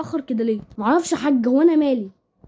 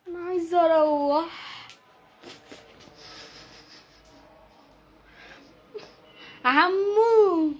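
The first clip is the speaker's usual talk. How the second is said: put-on small lost kid calling out; whimpering child; much slower than usual.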